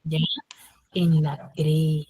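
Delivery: slow prompting whisper; feed the answer softly, unvoiced; hint whispered to a stuck student. Real, not acted.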